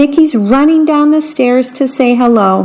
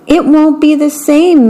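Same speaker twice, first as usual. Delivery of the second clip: higher-pitched, mild fussing; 6 semitones higher than usual. A narrator's reading. Mickey fusses over her broken teacup, mildly upset and higher. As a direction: fuss like an upset child in a read-aloud. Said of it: "Mickey fussed".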